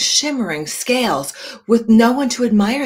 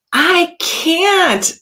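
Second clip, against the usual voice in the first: high, louder blurt; protesting fish; 11 semitones higher, 5 dB louder than usual. Acted. Rainbow Fish protesting to the octopus, high and loud. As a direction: start to refuse, cut off. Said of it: "started to say".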